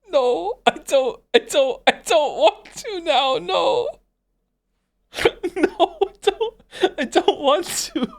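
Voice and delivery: high-pitched giggly refusal; laughing. Real, not acted. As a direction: refuse while cracking up. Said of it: "I'm still laughing".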